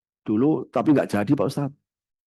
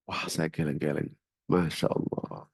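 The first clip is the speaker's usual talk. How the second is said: whispered "Masya Allah", much softer; hushed disbelief; 8 semitones lower, 9 dB quieter than usual. Real, not acted. Preacher shocked by the fifty million fee, whispering. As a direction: breathe it out in dismay.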